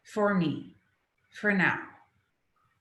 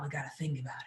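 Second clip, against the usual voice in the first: whispered caution; 6 semitones lower than usual. Retold or imitated. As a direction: whisper it like a worried mind. Voicing the cautious intellect.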